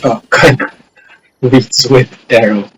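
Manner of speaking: normal talking voice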